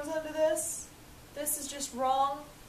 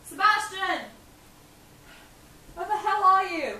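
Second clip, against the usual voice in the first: higher and louder furious call; angry shout; 5 semitones higher, 5 dB louder than usual. Acted. Young master yelling for his butler Sebastian, furious shout. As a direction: yell his name through the house.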